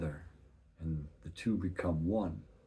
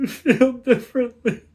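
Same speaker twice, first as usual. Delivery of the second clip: much higher, louder laughing squeal; high laughing break; 16 dB louder than usual. Real, not acted. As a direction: crack up mid-word.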